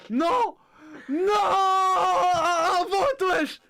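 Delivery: high, loud, gleeful yells; excited shouting. Real, not acted. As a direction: shout with delight. Le chat choisit par vote. (cris aigus et joyeux)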